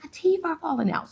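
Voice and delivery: high-pitched, incredulous mock panic; retold breakdown. Retelling her refusal to go out with teeth falling, mock breakdown. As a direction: replay the meltdown with pitch way up. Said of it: "So I'm having this whole breakdown".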